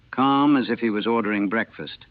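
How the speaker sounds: ordinary delivery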